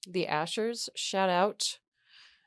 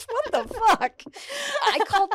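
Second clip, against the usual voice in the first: laughing; 14 semitones higher, 4 dB louder than usual. Real, not acted. Laughing disbelief at the tip.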